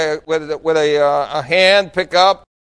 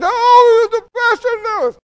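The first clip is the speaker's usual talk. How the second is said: put-on very high, wailing little old lady voice; crying old lady; 5 dB louder, slower than usual. Elderly churchgoer realizing the pastor knows, high mock wail. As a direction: pastor imitates her high, weepy dismay. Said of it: "So she started crying".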